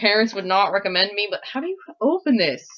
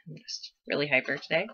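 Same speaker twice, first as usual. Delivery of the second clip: ordinary talk, nothing marked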